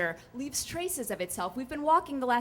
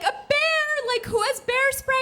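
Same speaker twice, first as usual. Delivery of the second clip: much higher, louder alarmed squawk; panicked campers; 14 semitones higher, 7 dB louder than usual. Retold or imitated. Group rushing over, crying out in alarm, high and loud. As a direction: flap about in comic panic.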